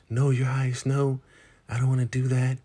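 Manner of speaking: a hint of a high, pleading woman's voice; high-pitched acted refusal; demurring woman. Woman declining ruler's violent offer.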